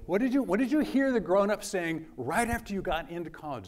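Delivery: usual speaking voice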